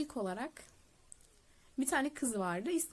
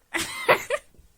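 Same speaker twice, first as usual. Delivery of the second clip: very high, loud burst of laughter; high shrill laugh at the memory; shrieking laugh; 11 dB louder than usual. Real, not acted. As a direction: let out a piercing squeal of a laugh. Laughing at the insult.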